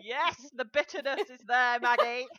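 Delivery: knowing retort. Retold or imitated. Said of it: "I was like".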